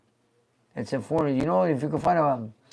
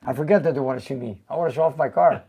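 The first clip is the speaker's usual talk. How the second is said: ordinary talk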